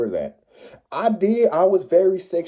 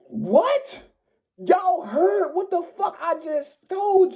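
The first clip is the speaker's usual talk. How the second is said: disbelief; 14 semitones higher than usual. Real, not acted. Retelling disbelief at accusation.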